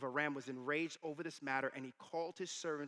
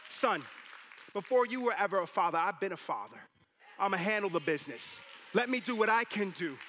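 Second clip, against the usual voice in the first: loud, raised fatherly declaration; emphatic preaching as God; 4 semitones higher, 7 dB louder than usual. Acted. God telling a worried father He'll handle it, emphatic. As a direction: preach it at full force, building. Preacher voices God's answer.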